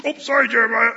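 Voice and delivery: loud, careless mock apology. Rescuer dumping rags carelessly on Jeremiah, offhand. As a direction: toss it off carelessly. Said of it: "No, it wasn't like that".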